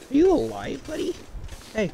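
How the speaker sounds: high, loud pet talk. Real, not acted. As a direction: coo loudly at the dog. Talking to pet dog.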